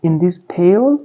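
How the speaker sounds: put-on high little girl's voice asking; high, wide-eyed child's question; young girl. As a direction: pitch it up as a curious young girl. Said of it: "a girl asked".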